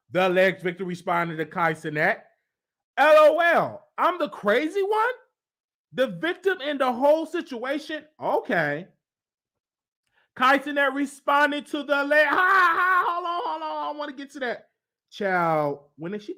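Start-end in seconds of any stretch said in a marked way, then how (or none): 3.0-8.1 mock indignant woman. Reading the woman's indignant reply. 12.3-13.0 short amused laugh. Real, not acted. Reacts while reading.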